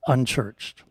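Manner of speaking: usual speaking voice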